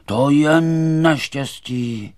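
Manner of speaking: high, loud, drawn-out lament; slow wailing exclamation; crying out. A narrator's reading. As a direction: cry out in dismay, stretching the words. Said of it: "někdo vykřikl".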